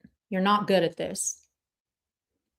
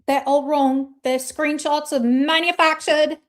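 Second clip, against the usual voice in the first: raised, put-on English accent; mock English accent; 5 semitones higher than usual. Streamer parodying a critic denying screenshots, mock English accent. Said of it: "with an English accent".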